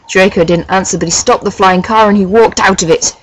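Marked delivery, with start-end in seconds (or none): none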